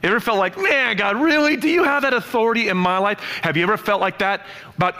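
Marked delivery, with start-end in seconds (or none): 0.6-3.2 exasperated complaint. Retold or imitated. Listener's voiced complaint to God.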